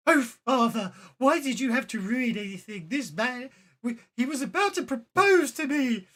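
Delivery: high, loud exasperated wail; protesting daughter. Acted. Daughter scolding father for ruining her proposal, exasperated. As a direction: whine loudly in theatrical dismay.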